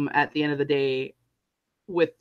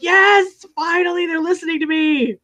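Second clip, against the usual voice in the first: far higher, louder yes finally; triumphant; 12 semitones higher, 7 dB louder than usual. Retold or imitated. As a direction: cheer like you've won at last. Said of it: "I'm like".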